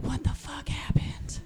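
hushed, slow horrified question; shocked whisper. Retold or imitated. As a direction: whisper it like he can't believe his eyes. Husband walks in, shocked.

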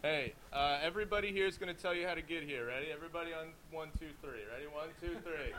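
distant phone voice; talking away from the mic; far from mic. Real, not acted. Speaking into phone.

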